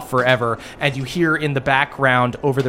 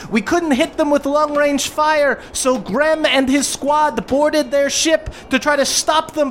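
high, urgent goblin captain voice; 12 semitones higher than usual. Acted. Ma Goblin tells party Grem is trapped, high urgent voice. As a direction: explain the crisis fast and worried. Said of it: "you hear her go".

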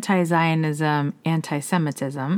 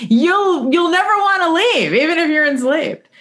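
high-pitched jokey tourism pitch; comedic mock slogan; 11 semitones higher, 5 dB louder than usual. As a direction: sell it as a silly advert, pitch way up. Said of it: "that's my comedy".

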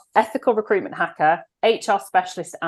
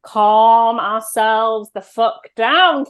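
very slow, word by word; deliberately slowed down; 3 semitones higher, much slower than usual. Shown as a demonstration. High-energy host telling guest to calm down, drawn out slowly. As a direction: drag it out to rein in the energy. Demonstrating calming down after fast excited talk.

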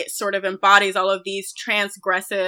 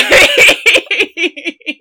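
laughing; 7 semitones higher, 8 dB louder than usual. Real, not acted. Laughing at joke.

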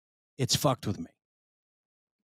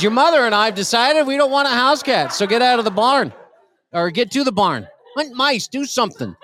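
mock angry dad; 7 semitones higher than usual. Dad scolding in read story, laughing.

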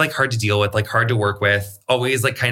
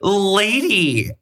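high, drawn-out exasperated address; retold exasperation; 9 semitones higher than usual. Hairdresser answering an accusing client, high and exasperated. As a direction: stretch the word out in mock protest.

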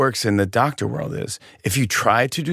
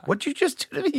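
pitched way up, hurt and baffled; squeaky indignant boxer voice; high mock Tyson; 5 dB quieter than usual. Comic Tyson squeaking at the man who swung at him.

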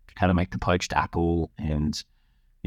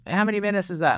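little son; 13 semitones higher, faster than usual. Retold or imitated. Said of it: "He goes".

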